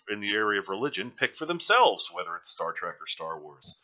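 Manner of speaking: normal talking voice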